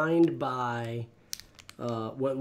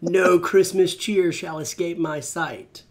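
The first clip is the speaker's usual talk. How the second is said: mock heroic oath; 3 semitones higher, 4 dB louder, slower than usual. Reciting Green Lantern oath.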